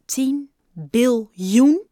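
very slow, loud, rising question; stunned disbelief. A narrator's reading. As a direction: draw out each word in astonishment. Bob stunned, chocolate falls from mouth. (stomverbaasd, langgerekt en luid)

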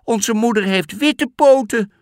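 put-on high, loud little kid voices; goat kids. Goat kids refusing the wolf, high insistent voices. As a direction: insist like scared small goats.

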